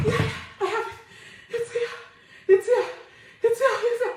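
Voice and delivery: high, broken-up excited retelling; frantic relief. Retold or imitated. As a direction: act out blurting it over and over, pitched high. Acts frantic relief at finding document.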